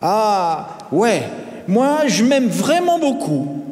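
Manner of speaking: put-on high, drawn-out boyish boast; self-loving boy cutting in; exclaiming. A narrator's reading. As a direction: burst in, full of yourself. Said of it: "s'exclama Martin, coupant ainsi la parole".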